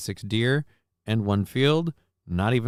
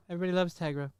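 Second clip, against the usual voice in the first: soft coo to cat; 6 semitones higher, 10 dB quieter, much faster than usual. Real, not acted. Softer repeat to cat.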